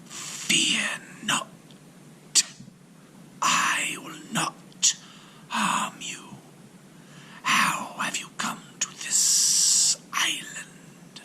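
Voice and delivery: slow, airy serpent whisper. A narrator's reading. Serpent threatens the shipwrecked sailor in a whisper. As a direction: breathe it out like an ancient snake. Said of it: "he said".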